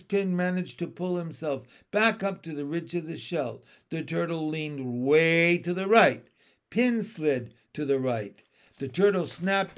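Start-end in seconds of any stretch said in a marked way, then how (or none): none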